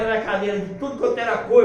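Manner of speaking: ordinary talk, nothing marked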